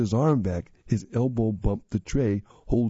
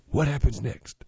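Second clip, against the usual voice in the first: ordinary delivery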